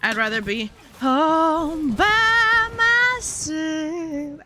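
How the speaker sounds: high, slow, sung-out proclamation; dramatic declaration. Acted. Vlogger declaring she'd rather be alone, high and drawn-out. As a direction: proclaim it theatrically. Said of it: "glad to be out".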